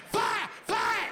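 sharp high yelled "Fire!"; mock gunshot shout. Comic miming a move, yelling like a gunshot. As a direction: bark it out like a gunshot.

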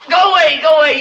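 scared, insistent shout; frightened. Acted. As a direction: drive them off in panic. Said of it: "You frighten me".

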